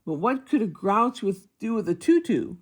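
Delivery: ordinary delivery, nothing marked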